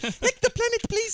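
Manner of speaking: high, lilting accented impression; Kira. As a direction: plead in a put-on high accent. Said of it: "doing your dumb impression".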